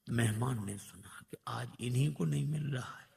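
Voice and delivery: hushed whisper of the guest overhearing; storyteller drops to a whisper. A narrator's reading. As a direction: breathe it like a secret.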